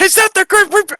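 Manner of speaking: loud, high mimicked yell; gruff voice imitation. Player echoing the game master's character, mimicked gruff shout. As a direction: parrot the gruff holler at full volume.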